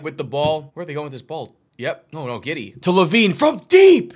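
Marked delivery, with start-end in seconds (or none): 2.8-4.1 high, loud, rising to a shout; excited shot call. Real, not acted. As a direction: build to a shout on the long shot.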